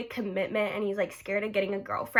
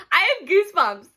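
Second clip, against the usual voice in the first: higher, laughing through the words; giggly goosebumps; 6 semitones higher, 5 dB louder than usual. Real, not acted. Vlogger giddy about Matt, laughing as she speaks. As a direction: say it half giggling, embarrassed. Flustered over her crush.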